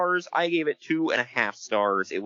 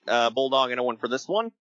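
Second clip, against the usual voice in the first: ordinary delivery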